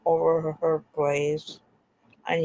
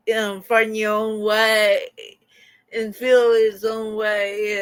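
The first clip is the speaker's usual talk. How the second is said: usual speaking voice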